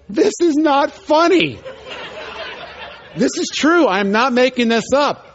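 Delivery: high, loud protest; pleading that it's really not a joke; insistent. Real, not acted. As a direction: swear to them it's true. Insists the story is true.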